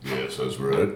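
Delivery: low voice muffled by a mask; through a face mask. Acted. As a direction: talk from behind a helmet mask. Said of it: "I couldn't understand anything you were saying".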